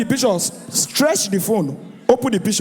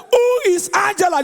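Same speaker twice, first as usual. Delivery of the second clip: mock wife accusing; 9 semitones higher than usual. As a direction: demand it like a suspicious wife. Mock wife confronting husband.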